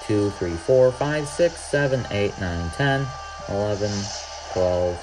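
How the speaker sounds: ordinary talk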